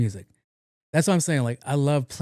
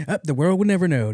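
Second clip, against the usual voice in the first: higher, proclaimed aside; mock dramatic; 5 semitones higher, 4 dB louder than usual. Host wondering about an ex, mock dramatic answer. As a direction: declare it with playful drama. Acts out own musing reply.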